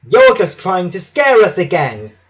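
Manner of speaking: indignant cry. A narrator's reading. James and Percy dismissing Thomas's fright, indignant cry. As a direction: cry it out, refusing to believe. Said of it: "they cried".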